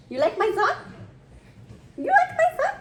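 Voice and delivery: very high, playful cooing question. Real, not acted. Baby talk to little girl.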